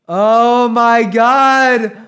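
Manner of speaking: very slow, high, loud drawl; blissed-out drunk. Retold or imitated. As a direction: stretch it out, wasted and euphoric. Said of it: "all he says is".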